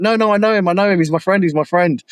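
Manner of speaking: high-pitched, hurried insisting; imitating urgent vouching for a friend; retold urgent plea. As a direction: re-enact calling off a scuffle. Retold stopping of a fight.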